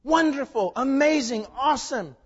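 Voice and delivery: high, loud exclamation; reflection screaming. Retold or imitated. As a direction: proclaim it like the mirror shouting. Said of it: "reflection staring back ... is screaming".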